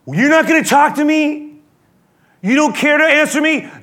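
high, loud, incredulous challenge; indignant demand. Acted. Pilate pressing a silent Jesus for an answer, loud indignation. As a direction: raise your voice sharply at the silent prisoner.

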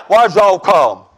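slow, gruff Southern deacon; rough Southern drawl. Retold or imitated. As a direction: drag it out in a Southern accent. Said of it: "he's kinda rough with me".

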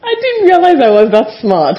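high, loud delighted disbelief; amazed at her own words; pleasantly surprised. Real, not acted. Reacting to hearing her old self.

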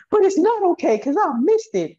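high, loud imitation of a wailing lifter; imitating a sobbing client, high and loud; mock wailing. Crying lifter voiced by coach.

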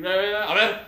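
ordinary talk, nothing marked

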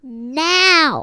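loud bratty demand; imitating a spoiled child's loud demand; demanding shout. Completing Veruca's spoiled demand.

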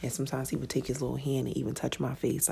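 normal talking voice

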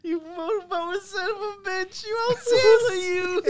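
high, wheezing through laughter; cracking up, barely getting words out; laughing disbelief. Real, not acted. Friend reacting to the motorboating story, laughing hard.

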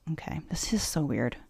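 hushed, stunned whisper. Real, not acted. Host reacting to the story, whispering. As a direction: whisper it under your breath. Shocked aside mid-story.

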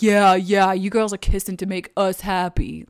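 a loud mocking dudebro voice; mock bro voice imitating leering men. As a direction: sneer it like a leering straight guy. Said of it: "straight men just looking to be like".

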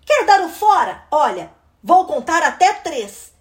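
fed-up sibling losing patience; exasperated threat. Acted. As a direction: warn the pest it's the last chance. Sister's final threat to pest.

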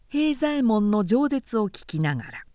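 ordinary delivery, nothing marked

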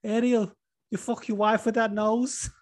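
Scouse accent, mocking question rising at the end; Darren Till to Ariel. Host channeling Darren Till's interview with Ariel, in Scouse. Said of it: "channel that interview with Ariel".

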